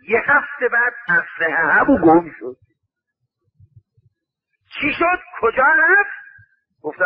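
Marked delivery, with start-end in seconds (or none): 4.8-6.1 alarmed questions. Acted. Acted alarm over lost rifle.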